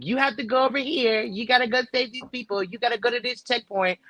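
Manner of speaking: nagging guide voice. Retold or imitated. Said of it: "somebody in my ear going".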